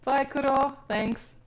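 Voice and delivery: ordinary talk, nothing marked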